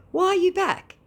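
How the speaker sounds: high, loud squeaky retort; mock high voice. Telling the scammer off, high mock squeal. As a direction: squeal it back mockingly.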